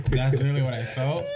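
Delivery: laughing. Real, not acted. Laughing at his own story.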